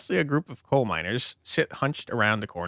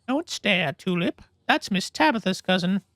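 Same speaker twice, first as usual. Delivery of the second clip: higher, hushing mother voice; young mother; 9 semitones higher, 3 dB louder, slower than usual. Acted. Mother hushing her staring child, higher voice. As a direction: gently scold the child.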